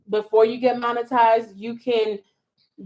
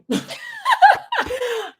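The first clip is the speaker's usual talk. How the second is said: a very high, loud laugh; real laugh, high and loud; high shrieking laugh; 4 dB louder than usual. Real, not acted. Laughing at her own joke.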